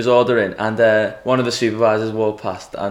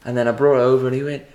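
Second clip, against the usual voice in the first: normal talking voice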